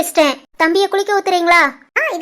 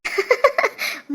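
soft, breathy chuckle; laugh; 4 semitones higher, 7 dB quieter than usual. Acted. Niece laughing at her uncle's teasing, soft laugh.

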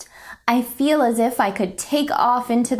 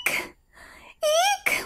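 high startled squeal; scared squeak; 5 dB quieter than usual. Acted. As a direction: squeak like a frightened mouse. Seeing something scary.

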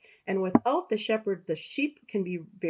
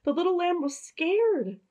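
higher, storybook narration; reading to children; 7 semitones higher, 4 dB louder than usual. A narrator's reading. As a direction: tell it like a bedtime story to kids.

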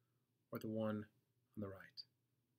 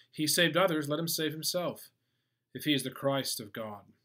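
loud, raised-pitch scoff; 5 semitones higher, 10 dB louder than usual. Acted. Ruler scoffing at Jesus on the cross, loud and scornful. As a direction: call it out as a loud jeer. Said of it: "the ruler scoffed at him".

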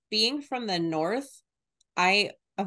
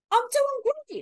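mock British accent. Mimicking British-accented actor.

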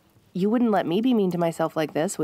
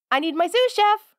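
playful call; 10 semitones higher, 3 dB louder than usual. Acted. Playful calling.

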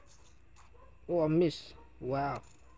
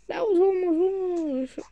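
high surprised cry; 10 dB louder than usual. Real, not acted. Near miss in the game.

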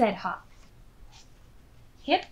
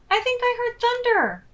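alarmed; 7 dB louder than usual. Acted. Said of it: "said Hop".

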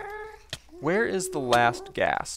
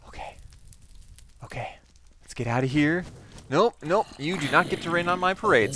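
ordinary delivery

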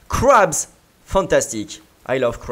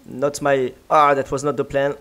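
usual speaking voice

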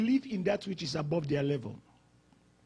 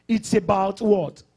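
normal talking voice

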